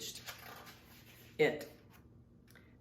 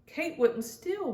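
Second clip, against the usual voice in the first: higher, slow and unsure; uncertain defence; 8 semitones higher, slower than usual. Acted. Mia defends Kate, unsure of it herself. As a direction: defend her friend but doubt creeps in. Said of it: "but she didn't sound certain".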